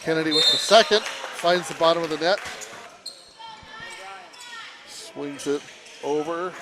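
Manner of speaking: fast, rising sports call; play-by-play. Acted. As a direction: call the action live, building. Live basketball play-by-play.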